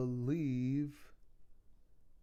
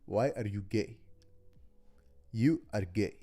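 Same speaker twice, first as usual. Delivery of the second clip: put-on Nigerian accent; 3 semitones lower, 3 dB louder than usual. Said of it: "please do your best Nigerian accent".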